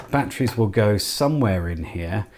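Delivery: ordinary delivery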